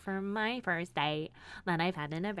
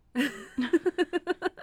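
real laugh; 7 semitones higher, 6 dB louder than usual. Real, not acted. Laughing about having no clue.

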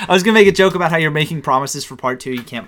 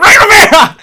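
sudden high yell, much louder; mock lecturer yell; 10 dB louder than usual. Sleep-deprived student mocking his professor with a yell. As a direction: blurt it out as a screaming astronomy professor. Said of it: "I just scream".

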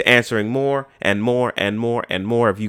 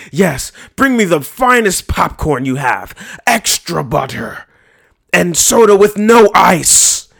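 slow, higher, regal bellow ordering snacks; mock Leonidas grandeur; 6 semitones higher, 4 dB louder, slower than usual. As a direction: command it like a king at a feast. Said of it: "And you'd be like".

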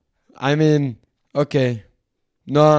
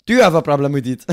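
hammed-up French accent, lightly put on; mock French accent; 4 dB louder than usual. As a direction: play a pompous French negotiator in a mock accent. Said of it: "Fuck this French accent".

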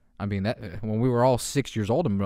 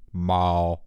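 deep cat meow; 6 semitones lower than usual. Retold or imitated. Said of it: "looks up at you and goes".

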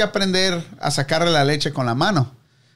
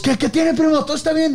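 concerned asking; 9 semitones higher than usual. Retold or imitated. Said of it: "Y todos".

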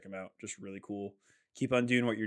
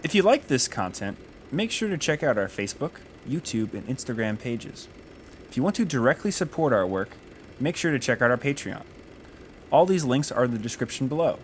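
louder, upbeat announcer read; podcast outro; 2 semitones higher, 6 dB louder than usual. Acted. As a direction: sell the outro like a host.

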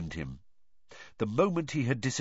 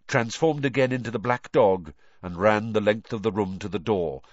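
ordinary delivery, nothing marked

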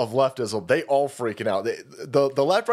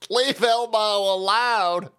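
mock plea; 2 semitones higher, slower than usual. Mocking pleading voice for Elmo.

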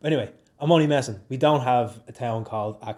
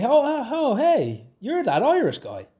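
a Finn excitedly recognizing him; high, excited "oh oh oh, hey"; Finnish locals spotting him; 11 semitones higher than usual. Retold or imitated. Locals in Oulu greeting him on the street, excited imitation. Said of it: "they'll say".

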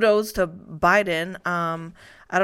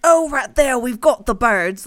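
nature narrator impression; 5 semitones higher, 7 dB louder than usual. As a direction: ham up a wildlife documentary voice. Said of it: "it's like".

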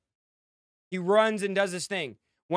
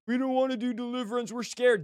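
higher, hurried imitation of timid leaders; mock scared whining; 3 semitones higher than usual. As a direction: mimic a frightened baby, mockingly. Mocks timid, baby church leaders.

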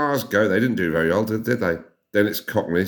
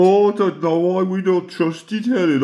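higher put-on Brummie voice; Birmingham accent; 8 semitones higher than usual. Speaking as a Brummie to Helen about trust, Birmingham accent. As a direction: talk like an offended Brummie. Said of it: "I think we've got a lovely accent".

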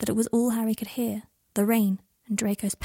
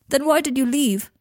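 higher, louder hurt outburst; Draco, wounded; 4 semitones higher, 5 dB louder than usual. Acted. Draco tells Harry he spat on his soul, raw. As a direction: lash out from a broken heart.

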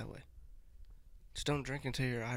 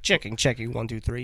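loud mic check; talking louder; 8 dB louder than usual. Real, not acted. Streamer checking the sound for viewers, loudly. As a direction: test the mic at a raised, projected volume. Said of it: "We need to talk louder".

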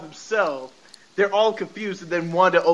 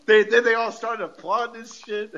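ordinary delivery

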